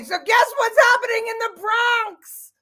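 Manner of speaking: high-pitched excited hook; excited announcement. Real, not acted. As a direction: announce it with glee.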